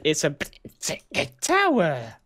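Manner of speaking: high, stretched-out put-on voice; silly high voice. Insisting to co-builder on the tower, silly high voice. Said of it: "I said big tower".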